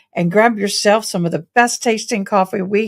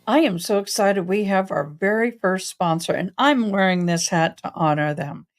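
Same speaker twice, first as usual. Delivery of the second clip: normal talking voice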